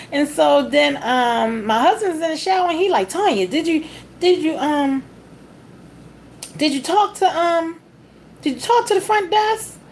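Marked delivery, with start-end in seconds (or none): 3.1-9.8 higher voice, halting as if calling from the next room; husband calling out. Retold or imitated. Husband in the shower asking his wife, calling out. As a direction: imitate a hesitant, high-pitched question.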